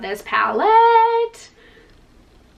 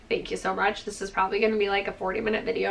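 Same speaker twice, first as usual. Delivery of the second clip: usual speaking voice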